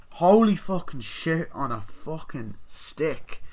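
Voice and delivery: ordinary talk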